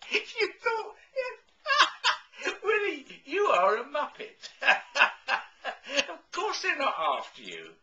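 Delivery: high and loud, laughing through the words; can't stop laughing at his silly fear; laughing at him. Real, not acted. Agent on the phone laughs at Willie, laughing hard. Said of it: "I don't mean to laugh".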